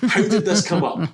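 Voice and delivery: a hint of a confused girl stopping strangers; mock Kirsten Dunst. Mocking a girl asking strangers if she looks like their daughter, laughing.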